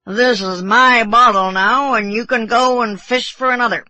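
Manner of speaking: put-on drunken bosun. A narrator's reading. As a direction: lay drunken claim to it loudly. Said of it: "how drunken it appeared".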